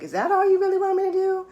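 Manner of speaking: high, quick questioning of God; incredulous questioning. Retold or imitated. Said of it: "I'm questioning".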